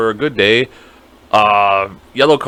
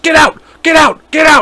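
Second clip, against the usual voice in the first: much higher, louder repeated yell; shouting; 14 semitones higher, 6 dB louder than usual. Retold or imitated. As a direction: bellow it like a guard clearing the aisles. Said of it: "going like".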